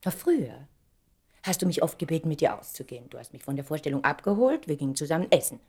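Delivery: ordinary delivery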